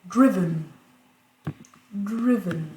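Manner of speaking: "Driven" said twice, first syllable strong, second falling; stress on first syllable. Shown as a demonstration. Teacher showing default pronunciation, "driven" stressed on the first syllable. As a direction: model "driven" with a punched opening syllable. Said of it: "la prononciation par défaut".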